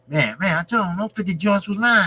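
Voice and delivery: New York guy talk. Retold or imitated. Imitating how New York guys talk to the chat, New York accent. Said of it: "that's how they talk".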